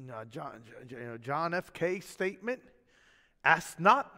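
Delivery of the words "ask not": a quick JFK-style Boston "Ask not"; hammed-up Boston accent on "not". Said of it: "that's my Boston accent".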